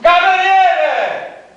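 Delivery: long high drawn-out shout; calling out. Acted. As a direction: stretch it out, high and slow. Calling the waiter louder.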